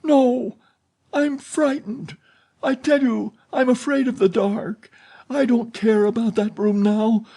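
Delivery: put-on frightened girl pleading against the dark; anguished fear. A narrator's reading. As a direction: beg him in panic, pitched high. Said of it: "Christine's voice of anguish".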